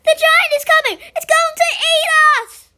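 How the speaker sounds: high, loud mock-panicked yell. Child joining the giant chase, panicked yelling. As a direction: yell a frantic warning. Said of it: "I join in yelling things like".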